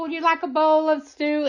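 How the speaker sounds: high, sing-song greeting in a mother-in-law voice; sweet high mother-in-law voice. Retold or imitated. Retelling the mother-in-law's welcome, in a lightly voiced high greeting. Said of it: "She sets it on the table".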